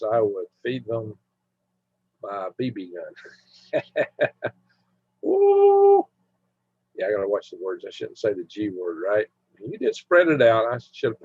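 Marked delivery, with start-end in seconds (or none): 5.2-6.1 loud, high-pitched "woo"; high whoop. Real, not acted. Whoops during livestream chat.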